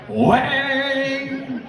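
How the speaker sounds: loud high gong-hit "Wang!"; imitated gong. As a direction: ring it out like a struck gong. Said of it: "produces a gong out of his jacket and goes".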